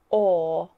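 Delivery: "or" as a long vowel, tongue kept flat; English "or" with the R left silent; non-rhotic. Shown as a demonstration. Teacher shows her own accent again, non-rhotic. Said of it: "our tongue stays flat".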